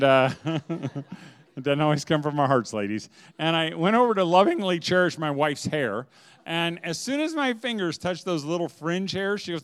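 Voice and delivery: ordinary talk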